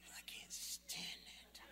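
unvoiced whisper under the breath; irritated whisper aside; whispered grumble. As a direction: mutter it privately so nobody hears. Inner grumble at chatty sister.

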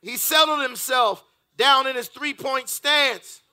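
loud, rising sermon climax; preaching at full force. Acted. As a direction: whoop the story like a play-by-play.

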